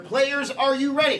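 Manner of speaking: high announcer hype; rally the players; announcer call. Acted. Host calls to players.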